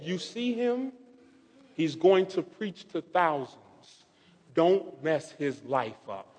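hushed, grave message; retold hushed warning. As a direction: imitate a solemn voice warning quietly. Retells mysterious voice's warning.

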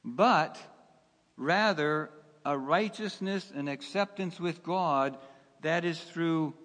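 normal talking voice

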